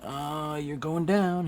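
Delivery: high mock battle threat; Shriek. Shriek firing at Venom, high-pitched mock threat. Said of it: "she's like".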